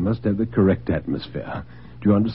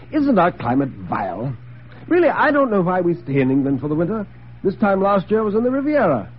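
higher-pitched society grumbler; Stone, complaining dinner guest; 8 semitones higher than usual. Acted. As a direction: lift the voice in idle complaint about the weather. Plays Stone making dinner small talk.

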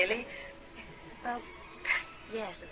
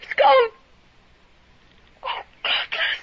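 shaken; 10 semitones higher, 6 dB louder, slower than usual. Acted. After the caller hangs up.

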